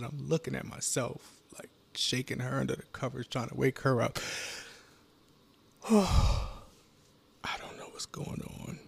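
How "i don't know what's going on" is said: heavy sigh into slow, breathy words; breathy, drawn-out bewilderment; distressed sighing. Acted. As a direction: sigh it out slowly, lost and bewildered. Distraught monologue, retelling a nightmare.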